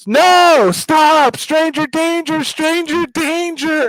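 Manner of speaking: high, loud shrieking kid voice, imitating daughter; child yelling. Daughter yelling stranger danger in the parking lot, high shriek. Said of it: "she's like".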